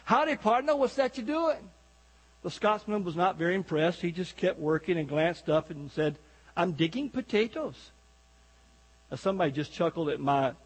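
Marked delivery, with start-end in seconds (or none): 0.1-1.7 Texan tourist calling out to a Scottish farmer, high mock holler; high-pitched, folksy Texan hail; shouting Texan. Bragging Texan greets a little farmer, hammed-up high call. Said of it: "he shouted out to this little farmer". 6.6-7.9 light mock Scottish farmer accent; unimpressed Scotsman with a lightly put-on Scottish accent. Scottish farmer answering a bragging Texan, light mock Scottish accent. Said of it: "Scottish accent".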